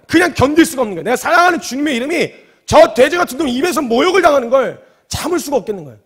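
loud, high, rising fervour; impassioned preaching. Real, not acted. Preacher extolling the boy who could not bear it, impassioned preaching. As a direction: drive the point home at full voice.

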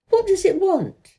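high, eager question; excited question. A narrator's reading. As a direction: ask it all wide-eyed. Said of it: "cried Colin".

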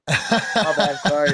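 a sheepish, laughing sorry after being caught out; laughing apology. Retold or imitated. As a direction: laugh through a quick sorry. Retold apology after taking off mask.

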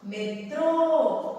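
Metrô with TRÔ high, loud and held; stress on last syllable. Shown as a demonstration. Teacher showing a word with circumflex, TRÔ drawn out. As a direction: draw out the final "trô" of "Metrô". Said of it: "tem um acento circunflexo".

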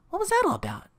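very high imitation of a puzzled woman; Rita's voice. Rita puzzled as Phil leaves, very high voice. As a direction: ask it pitched far up. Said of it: "sorry, that's, uh, Larry's line".

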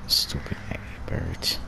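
whispered under the breath. Real, not acted. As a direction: hiss it softly to yourself.

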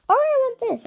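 eager high-pitched grab; excited want. Acted. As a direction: burst out with delight. Choosing items, whispered then exclaimed.

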